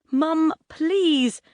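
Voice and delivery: high, urgent begging; pleading. A narrator's reading. Son pleading with mum for his computer, begging. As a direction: beg her desperately.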